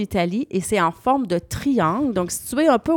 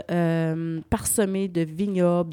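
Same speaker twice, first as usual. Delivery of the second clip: ordinary delivery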